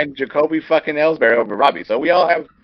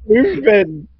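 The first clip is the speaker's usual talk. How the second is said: exasperated; 5 semitones higher, 5 dB louder, slower than usual. Real, not acted. Co-host cuts in on rant.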